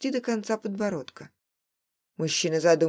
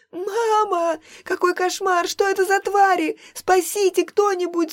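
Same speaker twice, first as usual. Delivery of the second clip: put-on much higher, louder screaming girl; terrified scream; 12 semitones higher, 7 dB louder than usual. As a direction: scream for help in horror. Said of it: "жуткий девичий вопль ужаса".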